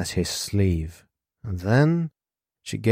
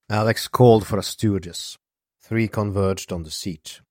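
ordinary delivery

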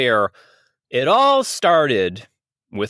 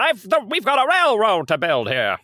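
put-on high-pitched old railroad man voice; old-time railroad man; 9 semitones higher than usual. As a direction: play a caricature railroad boss of old. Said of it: "I'm an old-time railroad man".

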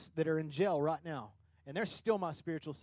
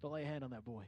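soft, lower voice; gentle God stopping Abraham; 5 semitones lower, 10 dB quieter, faster than usual. Retold or imitated. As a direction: quietly stop him, tender but firm. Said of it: "And God's like".

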